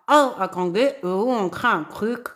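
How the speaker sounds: talking around a pen held in the mouth; words muffled by a pen in the mouth. Real, not acted. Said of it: "J'ai le silo dans la bouche, désolée".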